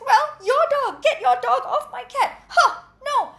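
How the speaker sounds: hammed-up row over cat and dog; mock quarrel.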